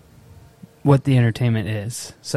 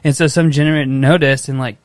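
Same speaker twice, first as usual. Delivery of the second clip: ordinary delivery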